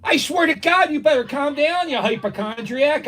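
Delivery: high, loud, fed-up warning; exasperated scolding. Real, not acted. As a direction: scold someone across the room. Quarrels with person off mic.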